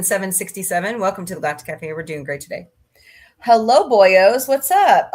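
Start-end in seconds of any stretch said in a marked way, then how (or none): 3.4-5.1 boisterous hello, voice jumping up on the end; playful loud greeting. Real, not acted. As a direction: greet the gang at full volume. Playful greeting to arriving viewers.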